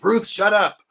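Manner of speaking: put-on old husband snapping at wife Ruth; grumpy snap. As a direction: cut her off gruffly. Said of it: "he goes".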